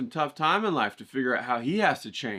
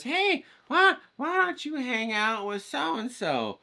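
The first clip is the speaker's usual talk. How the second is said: much higher, put-on mom voice slowing down; mom's voice; 11 semitones higher, slower than usual. Mom urges her son to hang out with the rich kid, high and sweet.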